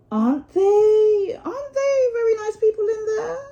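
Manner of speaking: anxious high squeak of a question; nervous Mole. Acted. Mole asks Rat about the Wild Wood, high and nervous. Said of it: "a trifle nervously".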